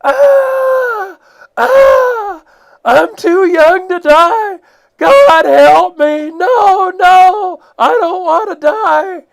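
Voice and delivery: imitating a drowning man's screams, very high and loud, slow; mock panicked screaming. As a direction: wail like you're being sucked down the rapids. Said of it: "you hear this".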